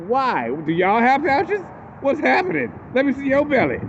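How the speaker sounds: high, rising mock-outrage; incredulous rant. Real, not acted. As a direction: pile on the disbelief. Mocking the belly checkers.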